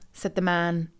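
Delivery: usual speaking voice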